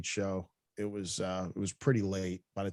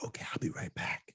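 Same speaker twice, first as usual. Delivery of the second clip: soft, unvoiced whisper; a whispered reply from the cashier; 4 semitones lower, 9 dB quieter than usual. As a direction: breathe the line like a secret. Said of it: "She was like".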